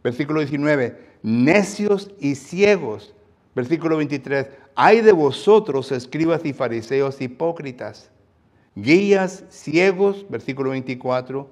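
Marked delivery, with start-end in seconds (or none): 1.2-3.1 high, loud, very slow condemnation; scornful rebuke. Acted. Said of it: "noten en cómo Jesús aquí viene a decirles". 4.7-10.3 loud, high, slowed denunciation; thundering woe. Acted. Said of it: "noten en cómo Jesús aquí viene a decirles".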